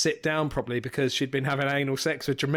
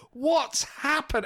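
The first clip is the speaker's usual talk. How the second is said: high, drawn-out baffled question; mock bewilderment; 8 semitones higher, much slower than usual. Baffled listener tuning in to episode four nine nine, mock bewildered. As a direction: stretch it out as a lost listener.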